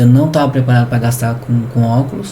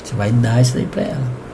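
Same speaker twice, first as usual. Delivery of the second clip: much softer, like a voice in his ear; soft inner voice; 4 semitones lower, 7 dB quieter than usual. Retold or imitated. Holy Spirit speaking softly in his ear. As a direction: softly retell what God whispered to him. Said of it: "o Espírito Santo de novo".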